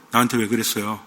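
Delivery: low, hushed film-hero question; movie-line impression. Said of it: "그 무슨 영화죠?".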